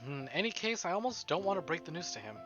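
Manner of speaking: ordinary delivery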